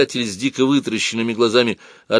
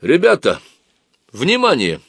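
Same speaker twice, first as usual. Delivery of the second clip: confident, raised announcing voice; уверенный громкий голос; 6 semitones higher, 5 dB louder than usual. A narrator's reading. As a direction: call the group to attention, pitch lifted. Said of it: "провозгласил он уверенным громким голосом". (уверенно и громко)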